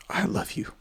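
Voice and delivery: breathy whisper, almost no voice; whispered goodbye to a late friend; whispered love. Real, not acted. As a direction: whisper it to someone gone. Farewell to a dead friend.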